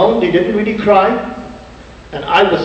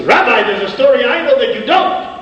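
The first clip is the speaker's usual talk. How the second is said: put-on higher, firmer retelling of an old man's command; 6 semitones higher than usual. Old man silences the rabbi, in a commanding tone. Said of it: "In the commanding tone of voice".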